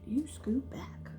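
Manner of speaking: soft hushed order; whisper. Acted. Jaya whispers at the fairy to scoot back. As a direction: shoo it away in a whisper. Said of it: "She said".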